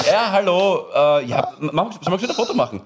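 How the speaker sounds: awkward greeting. Retold or imitated. Host reenacting his photo offer to fan, awkward. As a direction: stumble lightly through your own embarrassed hello. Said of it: "immer so ein bisschen peinlich".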